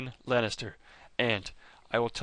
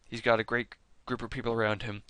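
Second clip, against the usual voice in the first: ordinary delivery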